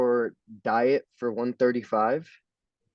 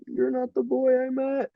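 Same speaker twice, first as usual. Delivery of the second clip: much higher mock hurt girlfriend voice; upset girlfriend; 14 semitones higher than usual. Girlfriend reacting to his shaved head, in a high mock-hurt voice. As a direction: squeak it out like a heartbroken girlfriend. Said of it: "she looked at me, she goes".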